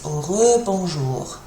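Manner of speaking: slow "rebonjour" with re as "ruh"; French re sound. Shown as a demonstration. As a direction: draw out the French schwa opening "rebonjour". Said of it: "It's that e sound".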